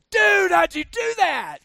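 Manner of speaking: loud slow amazed friend. Retold or imitated. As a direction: friend stunned by the trick. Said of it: "they'd go".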